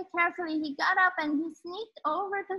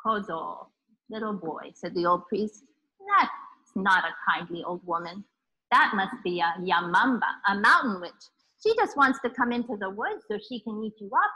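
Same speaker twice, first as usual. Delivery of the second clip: put-on low, grave old priest warning; 4 semitones lower than usual. Old priest warns boy.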